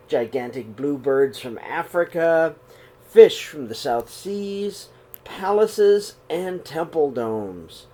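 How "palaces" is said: usual speaking voice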